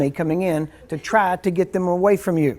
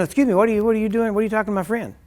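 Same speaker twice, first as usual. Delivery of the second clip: suspicious friend; 6 semitones higher, faster than usual. Pretend. Friend interrupts prayer suspiciously.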